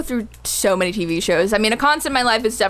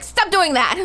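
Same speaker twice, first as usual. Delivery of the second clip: a hint of a scolding mother, higher; 7 semitones higher, slower than usual.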